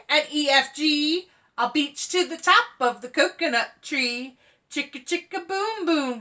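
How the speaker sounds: sing-song chant of the rhyme; rhythmic read-aloud. Acted. Teacher reading a rhyme book for kids to sing along, sing-song. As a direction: bounce through the rhyme almost singing. Reads rhyming alphabet book.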